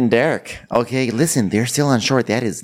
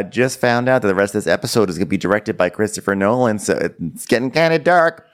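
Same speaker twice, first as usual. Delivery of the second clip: normal talking voice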